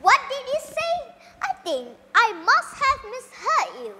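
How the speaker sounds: astonished father questioning what he heard; surprised king. A narrator's reading. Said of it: "The king was very surprised at her answer".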